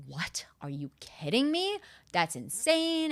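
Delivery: stunned disbelief, climbing high on the last words; incredulous. Retold or imitated. Client reacting to a coach's price, incredulous. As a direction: stretch out the final words in mock shock.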